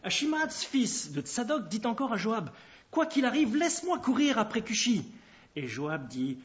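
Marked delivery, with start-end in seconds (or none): 2.9-5.0 nettement plus haut, supplique pressante; insistance ardente. A narrator's reading. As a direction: insiste pour qu'on te laisse partir. Achimaatz insiste auprès de Joab. (insistance fervente)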